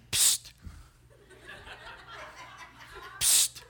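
short hissed "psst"; attention-getting "psst". Acted. Parrot calling the returning customer, hissed psst. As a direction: hiss to catch someone's ear.

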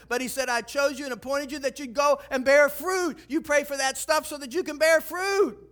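high, loud, driving sermon voice; fired-up preacher pressing his point home; preaching at full force. Acted. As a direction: build the congregation up, full power.

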